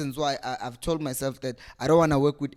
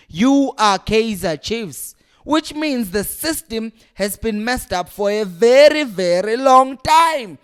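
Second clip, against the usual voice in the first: slow, higher, louder, every word hit; emphatic slow rant; 5 semitones higher, 7 dB louder than usual. Real, not acted. Fan lecturing the chairman, slow and emphatic.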